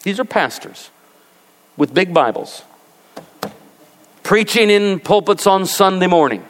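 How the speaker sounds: usual speaking voice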